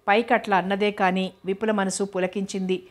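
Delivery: ordinary delivery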